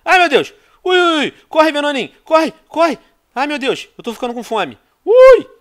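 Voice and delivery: panicked high yelps, fleeing; being chased. Real, not acted. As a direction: squeal in panic while running away. Shark chased while playing.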